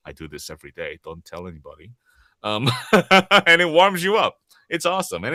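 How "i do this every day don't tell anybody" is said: much softer, lower, secret-sharing aside; conspiratorial aside. Acted. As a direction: drop the voice as if letting them in on a secret. Confiding a secret habit.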